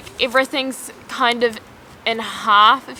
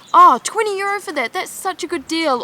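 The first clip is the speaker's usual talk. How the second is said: mock delight; 7 semitones higher than usual. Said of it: "took me a long time to be like".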